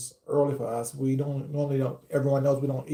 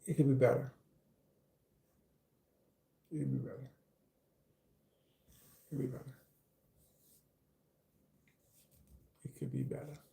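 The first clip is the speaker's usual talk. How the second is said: repeating it softer each time down to a whisper; fading to a whisper; 10 dB quieter, faster than usual. Real, not acted. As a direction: let it fade down to a breathy whisper.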